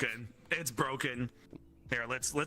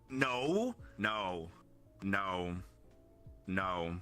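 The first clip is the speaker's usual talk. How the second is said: put-on lower, soft, hushing a child; mock soothing; 7 semitones lower, 4 dB quieter, much slower than usual. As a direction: soothe like a parent. Mock soothing baby talk.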